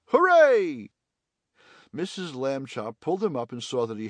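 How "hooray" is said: loud, high-pitched cheer; joyful cry out. A narrator's reading. Boy calling up from down the shaft, crying out loudly. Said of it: "Stanley cried out".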